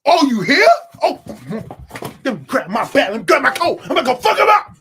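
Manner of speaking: loud, high, laughing outburst; hyped-up Godzilla impression. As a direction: act a riled-up fighter, cracking up. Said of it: "Godzilla saw Kong and was like".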